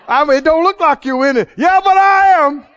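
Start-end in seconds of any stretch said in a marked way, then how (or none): 1.5-2.7 high, loud, slow insistence; defiant shout. Retold or imitated. Bloodied fighter insists loudly to the doubter. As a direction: dig in and declare it.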